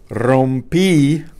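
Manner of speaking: rompí with a loud, held final í; stress on final I. Shown as a demonstration. Teacher modelling an answer for students, rompí stressed on the í. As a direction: land firmly on the last syllable of rompí. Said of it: "accent on the I".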